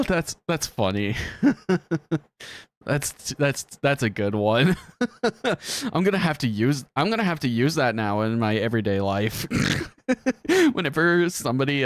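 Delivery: ordinary talk, nothing marked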